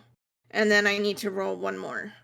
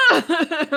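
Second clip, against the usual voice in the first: loud, higher mock death cry; imitated dying groan; 6 semitones higher, 4 dB louder than usual. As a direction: cry out like a dying creature, hammed up. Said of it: "The Rajan was just like".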